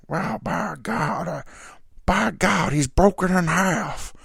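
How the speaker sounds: high, slow, Southern announcer call. Retold or imitated. Southern announcer calls Mick Foley's fall through the cage, dead-serious mock drawl. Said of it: "who's, like, the Southern Oklahoma guy".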